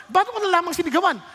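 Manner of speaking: usual speaking voice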